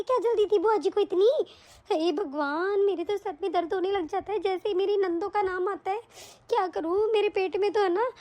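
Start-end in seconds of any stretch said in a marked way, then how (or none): 1.9-8.1 soft, put-upon groan; groaning complaint. Acted. Mother dreading her sisters-in-law, soft weary groan. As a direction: grumble much softer, as if a headache is coming.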